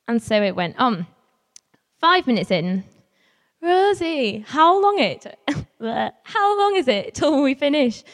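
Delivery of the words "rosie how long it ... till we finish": put-on higher, pleading kid's voice; whiny child. Girl asking Rosie when the maze ends, whiny.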